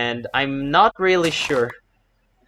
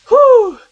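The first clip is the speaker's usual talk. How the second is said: relieved whew; 14 dB louder than usual. Real, not acted. Relief after close call.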